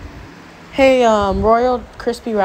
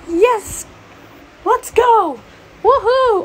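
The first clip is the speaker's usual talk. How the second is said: excited cheering; 12 semitones higher, much slower than usual. Acted. Character cheers at being safe.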